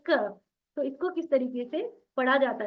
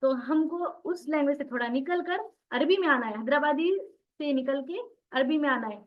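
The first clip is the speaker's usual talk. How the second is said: ordinary talk, nothing marked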